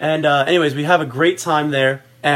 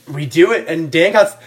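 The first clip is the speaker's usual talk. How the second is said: ordinary talk, nothing marked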